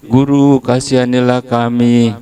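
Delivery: loud high call for mercy. Retold or imitated. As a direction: cry out to the teacher. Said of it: "si kusta itu juga bilang tuh".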